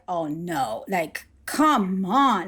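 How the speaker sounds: a slow, deflated oh no; weary dismay. Retold or imitated. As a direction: sigh it out like the fear is back. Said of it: "you go like".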